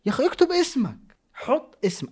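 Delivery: high squeaky mock-frustrated cry; exasperated plea. Pleading with anonymous viewers to write their names, high and whiny. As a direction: whine at them to write names.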